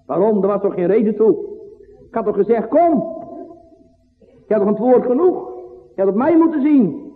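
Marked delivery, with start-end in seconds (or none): 2.1-7.1 voicing Jesus. Preacher voicing Jesus reproaching Peter, raised high rebuke. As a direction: rebuke like a master to his disciple.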